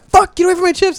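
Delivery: high-pitched retold protest; mock annoyance. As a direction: shoo a snack thief away in a high voice. Acted quarrel over stolen chips.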